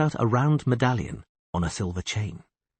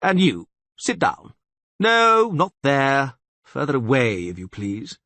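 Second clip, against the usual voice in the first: commanding castellan; 5 semitones higher, 5 dB louder than usual. Acted. Castellan ordering the stranger about.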